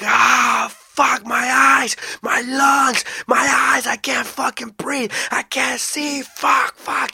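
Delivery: high, loud cries of agony; panicked, high-pitched yelling; imitated screaming. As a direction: act out a maced man screaming in a bathtub. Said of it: "He's screaming".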